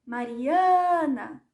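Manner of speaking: "Mariana" called slowly, swelling on the stressed A before "na"; long-drawn calling of "Mariana". Shown as a demonstration. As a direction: stretch the tonic syllable of "Mariana" like a call. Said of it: "vou chamar a Mariana".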